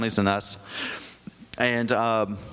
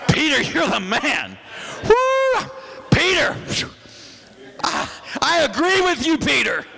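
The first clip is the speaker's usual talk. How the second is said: mock excited churchgoer; 9 semitones higher than usual. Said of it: "I'm going".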